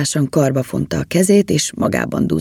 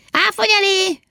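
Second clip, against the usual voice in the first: blaring shout. A narrator's reading. Elf shouting drink ideas from the cart, high blaring call. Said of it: "és egyre harsogott".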